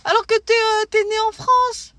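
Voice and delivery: high, slow, scornful put-down; mocking French person. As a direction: sneer at them like a smug local. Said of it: "Alors qu'en France".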